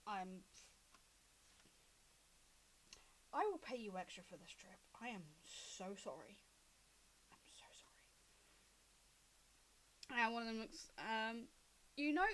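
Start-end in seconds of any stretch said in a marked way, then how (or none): 0.1-10.2 soft, low, contrite voice; subdued and sheepish; hushed apology. Acted. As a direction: apologize quietly, trailing away to almost nothing. Loma's sheepish apology to the drivers.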